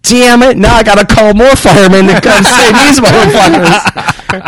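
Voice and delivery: high, loud, laughing through it; exasperated laughing curse. Retold or imitated. Man watching firemen fall on his waxed floor, high loud laughing curse. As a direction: laugh in disbelief at the slipping firemen. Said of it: "And I was like".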